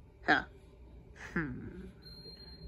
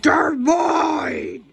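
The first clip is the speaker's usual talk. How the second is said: yelled possessive outburst; shouting; 7 semitones higher, 5 dB louder than usual. Real, not acted. Fighting over items, shouting.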